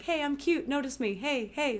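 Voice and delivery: high, bubbly bid for attention; eager high voice. Retold or imitated. Acting her eager chat messages.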